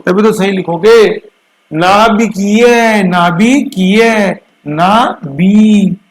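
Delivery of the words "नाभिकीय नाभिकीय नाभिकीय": नाभिकीय said slowly and higher, each syllable clear; teacher drilling नाभिकीय slowly; sounding out a word. Shown as a demonstration. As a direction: repeat नाभिकीय, slowing down each time. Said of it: "सही उच्चारण से".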